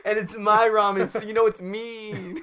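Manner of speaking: high, loud boasting huckster; brash pitchman. Acted. Attendant boasting of his ramen, brash loud pitch.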